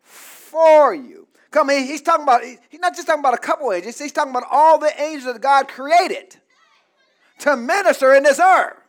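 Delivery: loud, driving sermon voice; preaching at full force. Acted. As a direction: thunder it out over the congregation. Preaching to congregation.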